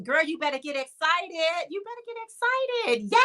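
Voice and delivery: high, rising cheer-her-on voice; excited hyping. Real, not acted. Streamer cheering on a viewer excited about spring colors, high and excited.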